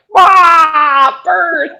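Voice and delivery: high, loud hammed-up wail; mock wailing men. Four paramedics faced with a birth, hammed-up crying wail. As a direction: whine it like a scared kid. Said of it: "and they're like".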